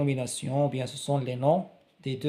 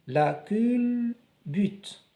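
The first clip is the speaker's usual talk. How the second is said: la culbute drawn out slowly, cul and bute split apart; sounded out; 6 semitones higher, much slower than usual. Shown as a demonstration. Teacher to pupils, splitting la culbute into slow syllables. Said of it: "on découpe les mots pour bien prononcer".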